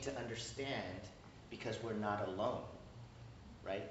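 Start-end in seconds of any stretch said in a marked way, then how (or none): none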